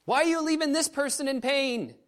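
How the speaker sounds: high, loud outcry of protest; arguing with God. Retold or imitated. Preacher challenging God over someone left in pain, anguished loud protest. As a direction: cry out at God in reenacted outrage. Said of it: "I'm arguing with God".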